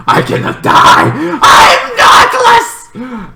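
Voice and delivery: very high, slow crying yell; defiant wail. Retold or imitated. Dying character's defiance.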